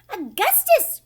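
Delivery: loud, high cry of the name. A narrator's reading. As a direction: shriek for her boy. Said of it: "cried Missus Gloop".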